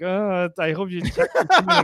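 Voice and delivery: nervous laughing plea; half-laugh it, fretting; laughing through worry; laughing hope. Retold or imitated. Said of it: "I'm just like".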